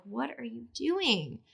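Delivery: soft, slow, baffled question under her breath; quietly stunned question to herself; quiet disbelief. Retold or imitated. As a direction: ask it quietly and slowly, stunned. Retold confused aside to herself.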